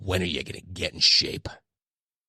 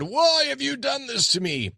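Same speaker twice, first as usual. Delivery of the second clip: mock cry to God. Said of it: "yelling and screaming at God".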